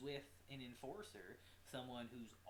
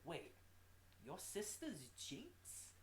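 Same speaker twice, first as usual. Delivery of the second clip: put-on slow, higher British-accented question; British accent impression; 5 semitones higher, 4 dB quieter, slower than usual. [name] questioning the sister's identity, mock British accent. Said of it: "In her British voice".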